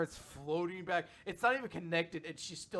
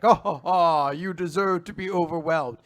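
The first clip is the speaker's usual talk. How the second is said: cocky, louder gloating; cocky villain; 3 semitones higher, 10 dB louder than usual. Retold or imitated. Retelling the cocky Demon Lord's gloating, mock-cocky and loud. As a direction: imitate a smug, overconfident lord. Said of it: "like, cocky".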